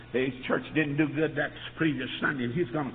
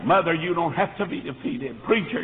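usual speaking voice

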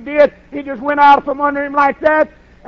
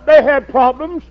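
ordinary delivery

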